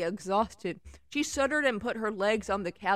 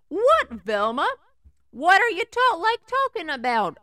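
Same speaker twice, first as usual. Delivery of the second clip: alarmed; 9 semitones higher, 9 dB louder than usual. Acted. Shaggy alarmed in story.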